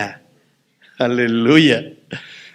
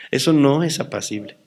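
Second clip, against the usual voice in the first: normal talking voice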